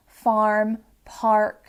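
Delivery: "farm, park" with the American r sounded. Shown as a demonstration. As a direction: say "farm, park" as farrm, parrk. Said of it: "teaching my American students, I am using".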